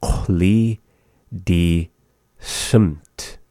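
"Chuli, di, simt" with a pause after each word. Shown as a demonstration. Teacher breaking down green mountainside for learners, word by word. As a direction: say "Chuli di simt" slowly, one word at a time.

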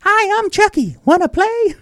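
high squeaky killer doll voice; creepy doll asking to play; Chucky voice. Pretend. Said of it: "It was a terrible impression".

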